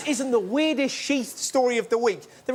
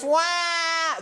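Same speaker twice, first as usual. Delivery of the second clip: high, drawn-out mock wail; imitated baby cry; 5 semitones higher than usual. Comedian mimicking a crying baby, high mock wail. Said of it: "The kid goes".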